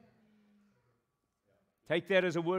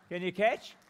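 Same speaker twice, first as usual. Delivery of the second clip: normal talking voice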